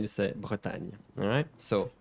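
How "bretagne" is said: "Bretagne" softly, ending in a light "ny"; correct French pronunciation. Shown as a demonstration. Teacher repeating the correction, soft French "Bretagne". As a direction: say "Bretagne" without a heavy final syllable. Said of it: "You don't say nye at the end".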